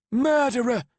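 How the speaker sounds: high, loud savage shout; grieving mother screaming at her son's killer; savage cry. A narrator's reading. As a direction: hurl the word at him. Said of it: "she continued savagely".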